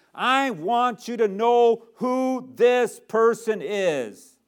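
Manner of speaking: loud, high, drawn-out proclamation; calling for attention. Acted. God calling everybody's attention to his Son, loud proclamation. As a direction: stretch each word out and demand attention. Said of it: "he's calling everybody's attention to the record".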